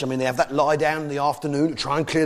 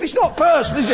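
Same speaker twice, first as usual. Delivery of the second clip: comic shout; 10 semitones higher, 4 dB louder than usual. Acted. Climax of cow bit.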